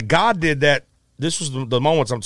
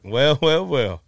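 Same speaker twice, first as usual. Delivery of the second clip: mild B B King imitation; 2 semitones higher, slower than usual. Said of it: "He was like".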